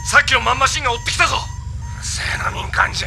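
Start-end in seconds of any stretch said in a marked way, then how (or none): 1.4-3.1 muttered grumble. Acted. Grumbling at a civilian partner.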